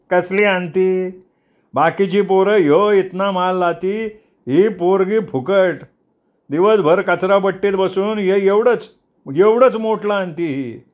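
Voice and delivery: high, stretched, carping whine; cawing complaint. A narrator's reading. Brother runs down the girl's market pickings, cawing. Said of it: "अंत्या कावकाव करत ओरडला".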